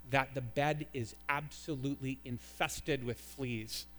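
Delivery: normal talking voice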